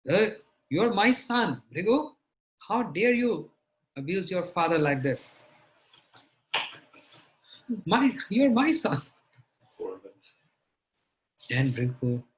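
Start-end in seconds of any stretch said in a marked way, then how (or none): 0.1-9.0 loud, high scolding of a wayward son; angry father. Acted. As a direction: rebuke him like an outraged father. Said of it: "Brahma became angry".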